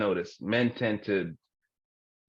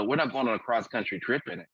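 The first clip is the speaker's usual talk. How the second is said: ordinary talk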